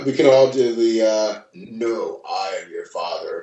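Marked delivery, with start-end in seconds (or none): 1.5-3.4 lightly voiced villain, low and soft; Darth Vader. Podcaster doing the "we can all do" movie impression, lightly voiced Vader. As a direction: drop low and quiet for the famous reveal.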